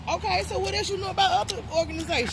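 challenging quiz. Real, not acted. Quizzing friend on other sororities.

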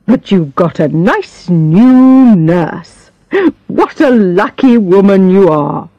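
lightly voiced cheery doctor, slow; a hint of a breezy country doctor humouring a patient; doctor teasing his patient, lightly jovial. A narrator's reading.

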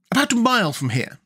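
put-on high, proud voice of a girl general; high-pitched young general, proud; General Ginger. A narrator's reading. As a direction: boast of your secret army. Ginger answering Tip.

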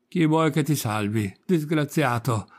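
ordinary delivery, nothing marked

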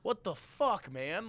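loud, high outraged yell; outraged shout. Retold or imitated. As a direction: blast him for drawing on you. Waking up to Sharpie on face.

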